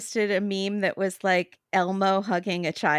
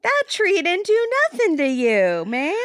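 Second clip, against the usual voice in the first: high mock protest; Debbie; 9 semitones higher, 4 dB louder than usual. Friend defending a tree to its attacker, mock protest. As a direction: stick up for the tree in exaggerated indignation. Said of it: "Debbie would be like".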